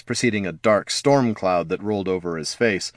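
plain reading voice

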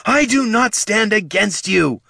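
high, slow yell. A narrator's reading. Sister yelling to her brother, high and slow. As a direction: call it out to him, slow. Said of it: "Ushirzu yelled".

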